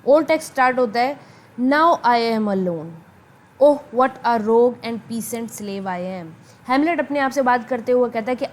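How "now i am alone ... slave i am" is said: slow, measured verse recitation; soliloquy reading. Acted. Teacher reads Hamlet's soliloquy to students, slow recitation. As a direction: read it out slowly, word by word. Reads the old text aloud.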